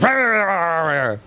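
wordless snarling squabble, falling in pitch; hammed-up grumbling rant; mock bickering noise. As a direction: imitate a nagging row in gibberish. Imitates couple bickering at home.

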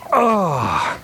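falling "oh" of strain; groan of pain. Acted. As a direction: groan as if passing a stone. Acting out passing the stone.

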